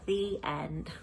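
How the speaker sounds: ordinary delivery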